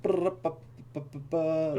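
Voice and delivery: fast stuttered brr bu-bu sound; quick drawing noise. Retold or imitated. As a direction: rattle off a quick scribble noise. Miming quick sigil drawing.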